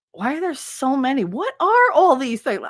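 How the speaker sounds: imitating an astonished kid; acted bewilderment. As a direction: gape at too many choices, voice lifted. Young self amazed by salad dressings.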